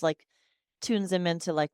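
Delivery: usual speaking voice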